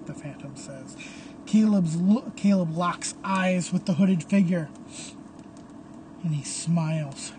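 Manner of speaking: ordinary delivery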